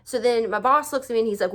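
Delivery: ordinary talk, nothing marked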